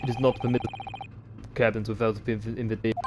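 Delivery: put-on stern Russian accent. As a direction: refuse like a Russian guard. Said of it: "I like to do Russian accents".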